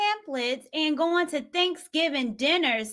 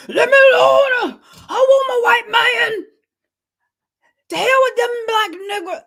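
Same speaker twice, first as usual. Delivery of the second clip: much higher, loud screamed impersonation; mock screaming; 10 semitones higher, 10 dB louder than usual. Host mimics the woman that was screaming, loud high mock scream. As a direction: scream it out, imitating her outburst. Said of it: "That woman that just was screaming".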